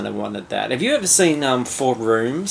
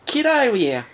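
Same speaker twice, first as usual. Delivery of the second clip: put-on much higher, fast, whiny Australian accent; pleading Australian Skrull. Cohost voices the Skrull begging a ride home, high Australian whine. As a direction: beg for bus fare like a down-and-out Aussie. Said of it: "a hundred percent Australian accent".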